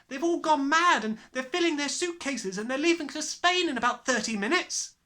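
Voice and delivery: put-on high, urgent frightened girl; panicked child. A narrator's reading. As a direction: rush it out in panic. Panting upset child.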